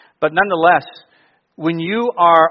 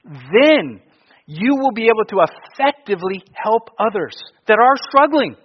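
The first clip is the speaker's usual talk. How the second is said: usual speaking voice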